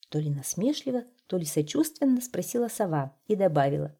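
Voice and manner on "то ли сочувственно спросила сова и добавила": plain reading voice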